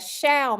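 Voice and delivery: "shall" set apart high, then falling; emphatic SHALL. Shown as a demonstration. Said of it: "means emphatically".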